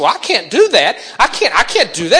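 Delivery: put-on high, loud insistent boy; stubborn young David. Young David refusing his brothers, high and loud. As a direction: dig in like a kid who won't back down.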